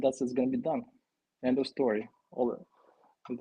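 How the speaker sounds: normal talking voice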